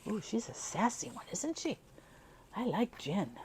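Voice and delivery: high amused gush; delighted aside. Real, not acted. Reader delighting in Jin to listeners, high gush. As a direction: coo over the character.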